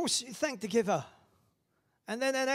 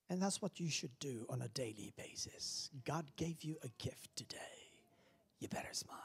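low, soft whispered sermon; hushed preaching; 3 semitones lower, 12 dB quieter than usual. Real, not acted. Preacher whispering to the congregation. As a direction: draw them in with a hush.

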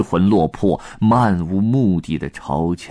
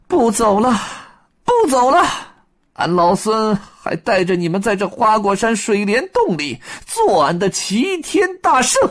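high Monkey King, laughing then commanding; high Monkey King voice, bold command; Monkey King rallying; 13 semitones higher than usual. Acted. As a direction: rouse the little ones to march home. Wukong resolves to stay.

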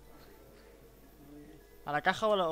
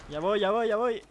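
higher and louder, rushing; hurried; 5 semitones higher, 6 dB louder than usual. Real, not acted. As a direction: call out while hurrying over. Rushing to help teammate.

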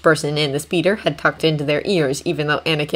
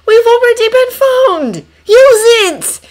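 high, loud frantic yell; exasperated shout; 9 dB louder, slower than usual. Acted. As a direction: yell at him to hurry. Obi-Wan shouts at Anakin.

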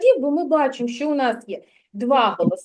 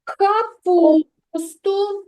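drawn-out echo; 5 semitones higher, 3 dB louder, much slower than usual. Shown as a demonstration. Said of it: "teacher echoes answer".

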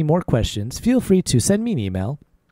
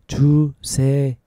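one syllable at a time; slowly; 2 semitones lower, faster than usual. Shown as a demonstration. Teacher showing learners another way to ask for writing, slowly. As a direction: take it slowly, pausing between syllables. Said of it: "take it slowly".

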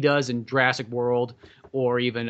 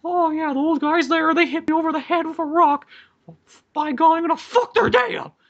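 imitating the tracker in a much higher, slowed, put-on voice; soft-voiced old tracker; 5 dB louder than usual. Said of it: "he talks in a soft voice where it's like".

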